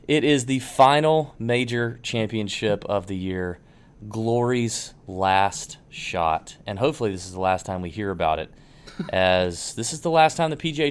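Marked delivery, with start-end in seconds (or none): 4.1-6.6 dramatic announcer. Acted. Reads the tournament's slogan dramatically.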